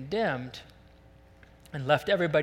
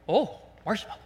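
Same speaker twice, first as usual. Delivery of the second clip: mock wonder, higher little "oh"; entranced pig; 5 semitones higher than usual. Pigs spot the marshmallows and forget everything, mock entranced. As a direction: play a pig suddenly smitten by a treat.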